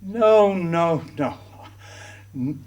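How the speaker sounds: usual speaking voice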